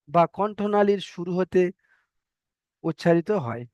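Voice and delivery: normal talking voice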